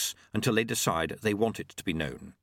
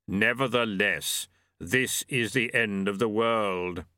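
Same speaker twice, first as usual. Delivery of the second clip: slow, solemn Death speaking; slower than usual. A narrator's reading. Death answers Famine.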